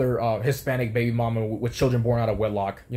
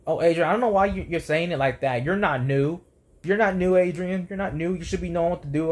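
high-pitched, mocking telling-off; mock nagging manager; 6 semitones higher than usual. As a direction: imitate her scolding in a higher voice. Mimicking a nagging manager's scolding.

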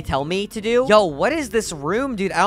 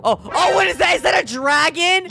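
high startled yell; scared shout; 10 semitones higher than usual. Real, not acted. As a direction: shout in sudden fear. Dragon appears.

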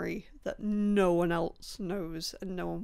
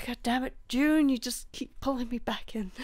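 higher, exasperated cry with the voice going; mock-cursing in a failing, strained voice; sigh, then groan it out as your voice gives out; voice giving out; 4 semitones higher, 3 dB louder than usual. Real, not acted. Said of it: "my voice is dying".

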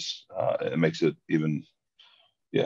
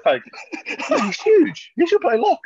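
very high, loud mock awe; hammed-up gushing about a mate; frothing admirer; 5 dB louder, slower than usual. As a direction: rave in a squealing fanboy voice. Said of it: "frothing over Charles Ollivant".

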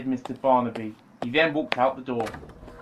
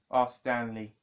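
ordinary delivery

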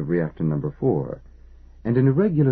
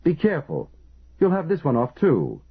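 high, urgent shouted warning; 8 semitones higher than usual. A narrator's reading. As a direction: yell the warning in alarm. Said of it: "he shouted".

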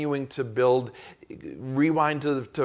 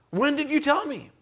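exasperated boss; 13 semitones higher than usual. Retold or imitated. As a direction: bark the question like a boss who won't listen. Boss blaming employee, acted exchange.

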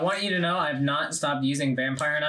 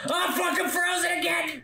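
high frustrated yell; shouting at the game; exasperated shout; 11 semitones higher than usual. Real, not acted. Frozen again in game.

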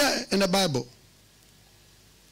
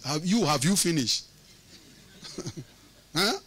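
ordinary delivery

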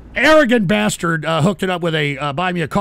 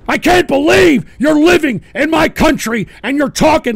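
raised pitch, punching the key words; outraged yelling; 4 semitones higher, 4 dB louder than usual. Real, not acted. Host berates draft-dodging listeners, outraged yelling. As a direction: yell it in indignant disbelief.

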